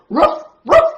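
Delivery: loud, high ruff ruff; dog barking. Acted. Storyteller barks for the dog to the kids. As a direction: bark out like an excited puppy. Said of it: "the dog barked".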